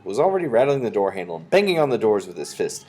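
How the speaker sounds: ordinary delivery, nothing marked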